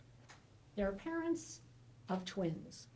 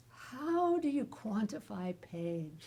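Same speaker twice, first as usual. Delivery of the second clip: slow wry question; slower than usual. Retold or imitated. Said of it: "sense of my husband".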